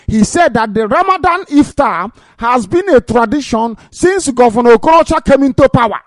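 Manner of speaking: beside yourself, voice climbing as you denounce it; real rage. Real, not acted. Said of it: "I am beside myself with rage".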